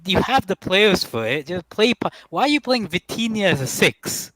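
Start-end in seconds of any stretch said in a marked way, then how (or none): none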